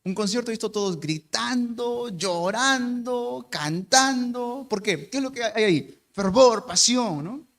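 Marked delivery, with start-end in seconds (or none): 1.0-4.6 slow, stretched out; drawn out. Acted. Pastor describes a fervent concert crowd, slowly drawn out. 6.1-7.2 loud, punched word; emphatic. Acted. Pastor answers his own question, loud and emphatic.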